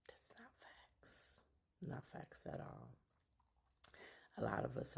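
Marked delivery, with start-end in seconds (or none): 0.1-2.9 emphatic whisper. Real, not acted. Dramatic denial, voice drops.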